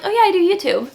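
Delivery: upbeat, self-assured answer; confident. Retold or imitated. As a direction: own it, announce it with pride. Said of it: "way more confident".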